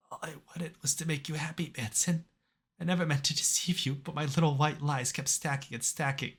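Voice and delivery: faint tearful confession; weak dying confession. Acted. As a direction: fade out as you confess.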